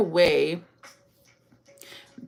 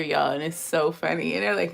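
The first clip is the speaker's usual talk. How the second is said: usual speaking voice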